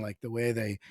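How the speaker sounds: ordinary talk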